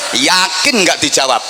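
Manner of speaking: usual speaking voice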